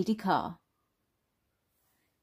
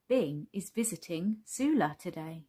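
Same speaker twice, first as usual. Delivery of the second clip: ordinary delivery, nothing marked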